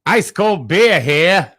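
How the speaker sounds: slow, high, loud Mid-Atlantic ballpark call; Mid-Atlantic accent. Pretend. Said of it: "That Mid-Atlantic accent".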